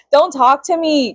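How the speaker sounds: ordinary talk, nothing marked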